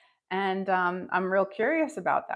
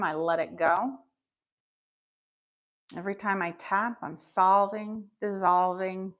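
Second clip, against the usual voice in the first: guided affirmations. Acted. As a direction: lead the affirmations gently, line by line.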